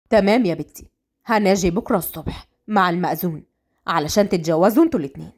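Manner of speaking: low, matter-of-fact elder; a father setting terms; father to daughter. Acted.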